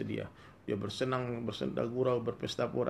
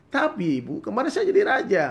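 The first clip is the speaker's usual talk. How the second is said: much higher, louder pleading insistence; insistent shout; 11 semitones higher, 10 dB louder than usual. Acted. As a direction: insist desperately that you were king. Son insists again.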